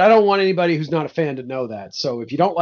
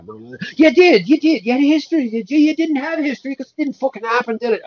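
indignant saint; 8 semitones higher, 4 dB louder than usual. Acted. Angry saint snaps back.

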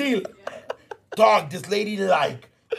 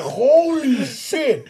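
drawn-out, high "holy shit" of shock; slow, amazed reaction; stunned; 6 semitones higher, 4 dB louder, much slower than usual. Retold or imitated. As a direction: stretch it out in retold disbelief.

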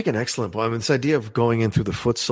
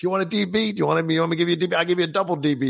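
put-on teasing girlfriend; 6 semitones higher, faster than usual. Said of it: "she's like".

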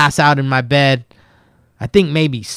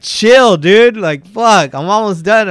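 higher and louder, irritated snap back; annoyed retort; 6 semitones higher, 5 dB louder, slower than usual. Retold or imitated. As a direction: tell him to back off, raised and exasperated. Retorting from inside the stall.

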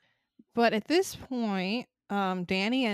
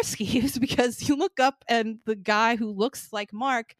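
ordinary talk, nothing marked

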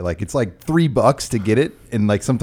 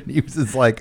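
normal talking voice

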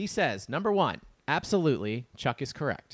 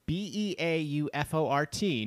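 usual speaking voice

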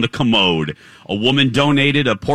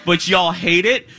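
ordinary delivery

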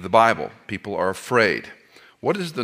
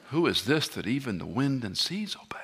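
whispered in frightened awe; hushed, awestruck whisper; awed disciples whisper; 6 dB quieter than usual. Retold or imitated. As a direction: breathe it like terrified disciples. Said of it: "the poor disciples were scared".

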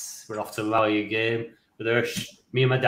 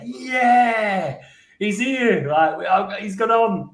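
high, drawn-out mock cheer; imitating an excited crowd; retold group cheer; 8 semitones higher, 3 dB louder, much slower than usual. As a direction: cheer like a coachload greeting a straggler. Retold cheer as he boards coach.

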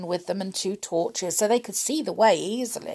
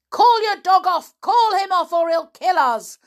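very high, very loud panicked cry; villain pinned by the dog, crying out in panic; 12 semitones higher, 9 dB louder than usual. Acted. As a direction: beg at the top of your voice. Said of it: "cried Mister Barling".

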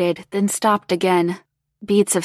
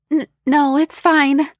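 put-on high, nervous stammering girl; flustered stammer; 8 semitones higher, 5 dB louder than usual. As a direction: trip over the words, flustered.

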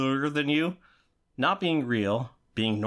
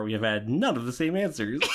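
ordinary delivery

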